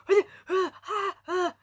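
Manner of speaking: high, loud mock shrieks; hammed-up screams of fright. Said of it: "they're like".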